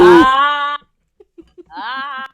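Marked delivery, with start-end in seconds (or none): 0.0-0.8 loud hammed-up gasp; mock moan. Reader hamming up the scene, mock moan. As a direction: yelp like a fake moan.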